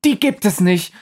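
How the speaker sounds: high, contemptuous snort. Acted. The faces scoffing at Pablo, high and contemptuous. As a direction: scoff at him. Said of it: "schnaubt ihn … an".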